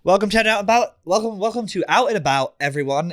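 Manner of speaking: high, loud announcer welcome; show intro. Acted. Host opens the show.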